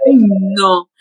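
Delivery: ordinary talk, nothing marked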